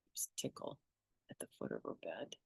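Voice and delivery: hushed aside, no voice at all; whisper. Real, not acted. Reader whispers to the listener about Tickle, hushed. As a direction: drop into a secret whisper. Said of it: "unvoiced whisper".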